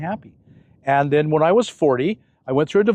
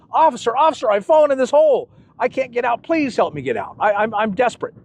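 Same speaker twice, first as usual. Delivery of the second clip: calling out; 7 semitones higher than usual. Retold or imitated. Man in hole calls officer.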